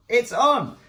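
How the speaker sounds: high, loud surprised burst; a sudden pleased yelp of surprise. Real, not acted. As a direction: blurt it out, delighted it works. Surprised camera works.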